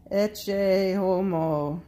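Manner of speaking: loud, drawn-out proclamation. A narrator's reading. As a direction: proclaim it slowly to a crowd. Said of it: "says in a loud voice".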